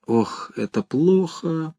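soft, slow worried mutter. A narrator's reading. Official mutters his alarm, softly and slowly. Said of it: "пробормотал Хлебоводов".

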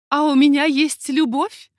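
ordinary delivery, nothing marked

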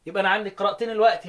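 normal talking voice

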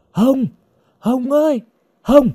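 high-pitched searching call; calling out loudly. A narrator's reading. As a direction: call the missing girl's name through the forest. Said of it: "Duy men theo cánh rừng nhỏ gọi to". (gọi to, giọng cao vút)

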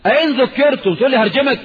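loud, high, rising disbelief; loud shocked "هرجمك" rising high at the end; indignant outcry. Real, not acted. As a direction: throw the question back at them in outrage. Indignant rhetorical outburst.

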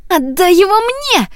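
high, sharp order; commanding girl. A narrator's reading. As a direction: order the horse to give him up. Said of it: "приказала Рапунцель". (высокий властный приказ девушки)

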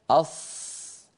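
أص whispered with rounded, pushed-out lips. Shown as a demonstration. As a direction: show the faulty ص by rounding the lips on أص. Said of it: "أص هكذا ويزم شفتيه". (أص بشفتين مزمومتين)

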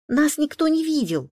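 put-on high, loud childlike blurt. A narrator's reading. Liza gives the twins away to her father, blurting. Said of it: "выпалила Лиза".

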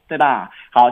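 ordinary delivery

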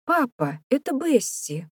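put-on high-pitched child bursting in with news; excited child's high cry; boy calling out. A narrator's reading. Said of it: "крикнул Левелин".

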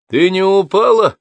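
high, loud call from the next room; calling through wall. A narrator's reading. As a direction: call out to someone behind a wall. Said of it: "раздался из-за стенки голос её мужа". (громкий оклик из-за стенки)

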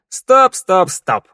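loud, high halting call, dropping at the end; sharp interruption. A narrator's reading. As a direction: cut in to stop them. Interrupts the plan abruptly. (резко перебивая)

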